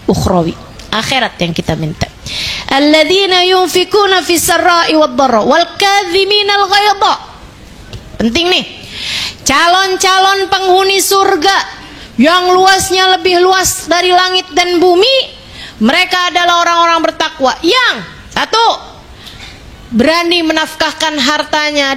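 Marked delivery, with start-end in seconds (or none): 2.7-7.1 raised, chanted Arabic verse; high, intoned scripture chant; Quranic recitation. Acted. 9.4-18.7 preaching. Acted. Preaching at raised pitch.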